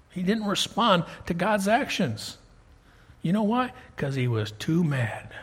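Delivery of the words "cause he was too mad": ordinary talk, nothing marked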